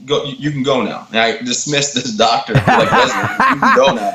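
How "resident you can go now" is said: much higher and louder, laughing mock dismissal; much higher, louder mock dismissal of the resident; mock haughty dismissal. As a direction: shoo him off in a hammed-up high voice.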